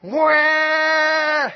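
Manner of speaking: long, loud, high blaring horn; sustained high honk at full volume; horn blast imitation. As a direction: blare it out like a foghorn. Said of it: "horn goes off".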